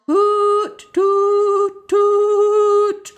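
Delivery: high, loud tooting like a horn; trumpet toots. A narrator's reading. Imitating the new noise, high loud tooting. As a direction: toot like a fire-engine horn. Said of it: "went that noise".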